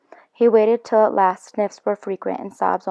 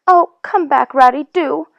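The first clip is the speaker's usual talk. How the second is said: much higher and louder calling after him; crying out; 7 semitones higher, 7 dB louder than usual. Acted. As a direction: call him back, pleading. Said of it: "cried the Mole".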